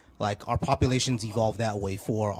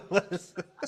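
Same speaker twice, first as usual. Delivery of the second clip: high, helpless laughter into the words; real giggling fit; laughing; 9 semitones higher than usual. Real, not acted. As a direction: barely get it out for laughing. Still laughing at the troll.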